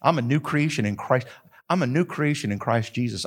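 ordinary delivery

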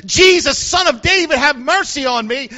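high, loud repeated plea; Bartimaeus. Retold or imitated. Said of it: "He raised the volume up".

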